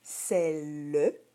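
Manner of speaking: "celle" in two slow syllables, final e sounded; "celle" split as cel-leu for dictation; spelling pronunciation. Shown as a demonstration. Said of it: "tu devrais l'écrire".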